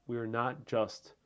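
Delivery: usual speaking voice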